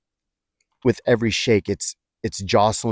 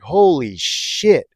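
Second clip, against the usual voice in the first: high, drawn-out retold yell, only moderately raised in volume; re-enacted yell; 7 semitones higher, 3 dB louder, much slower than usual. Storyteller repeats what he yelled on opening his eyes, high and drawn out. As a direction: stretch it out like a mock yell. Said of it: "I think I yelled it in front of everybody".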